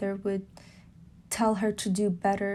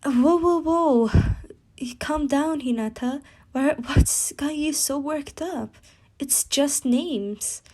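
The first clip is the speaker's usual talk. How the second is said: startled calming; 5 semitones higher than usual. Acted. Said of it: "would cut her off, saying".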